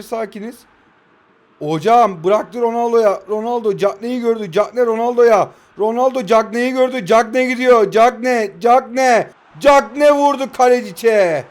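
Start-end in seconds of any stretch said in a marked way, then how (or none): 1.6-11.4 loud, rising match-commentator call; excited football commentary build-up; play-by-play commentary. Pretend.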